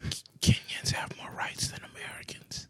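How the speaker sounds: hushed conspiratorial whisper. Acted. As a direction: whisper it like a secret. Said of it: "Why are you whispering?".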